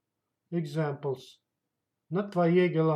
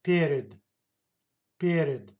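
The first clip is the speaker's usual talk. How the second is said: "Перед" with a strong PE and a weak, falling second syllable; stress on first syllable; 3 dB louder than usual. Shown as a demonstration. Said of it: "stressed goes on the first".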